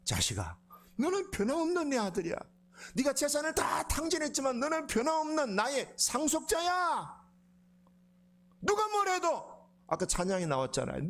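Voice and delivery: high, emphatic loving declaration; fervent reassurance. Retold or imitated. Father reassuring the repentant son, fervent loving voice. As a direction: insist on your love, voice lifted.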